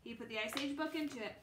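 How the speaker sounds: ordinary delivery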